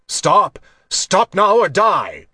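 forceful, high-pitched threat; roared command. A narrator's reading. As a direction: roar it out like a commander charging in. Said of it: "Commander Gare roared".

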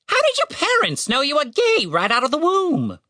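high, taunting bird voice; mocking raven. A narrator's reading. Raven taunts Tim about his parents, mocking. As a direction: taunt him in a squawk. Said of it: "sounded like a mocking laugh".

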